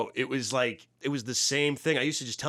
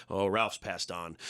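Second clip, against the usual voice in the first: mock solemn; 7 semitones lower, 4 dB quieter, much slower than usual. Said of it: "And I was just like".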